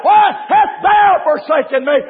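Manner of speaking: preached cry. Retold or imitated. Jesus separated from the Father cries out, preached cry. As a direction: cry it out mid-sermon at full force. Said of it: "and cried".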